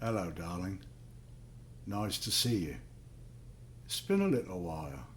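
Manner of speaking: sinister soft greeting. Acted. Man with an axe softly greets his wife in the cellar. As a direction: greet her sweetly with the axe in hand.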